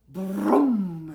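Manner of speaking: loud rumbling engine noise; big mock engine brum; rocket rumble. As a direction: roar it like a rocket taking off. Rocket sound in story.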